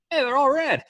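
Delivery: cheerful clueless soldier. Pretend. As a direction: chirp it like nothing's wrong. Imitates the colorblind bomb tech.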